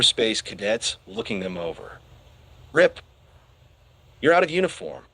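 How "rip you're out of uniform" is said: stern. A narrator's reading. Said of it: "Broadside said sternly".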